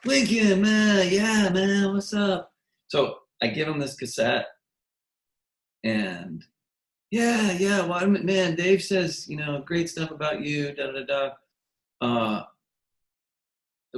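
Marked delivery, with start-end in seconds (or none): 0.0-2.5 high, louder catchphrase impression. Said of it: "he was that way the whole time". 7.1-11.3 high-pitched imitation easing downward. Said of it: "That's how he talks".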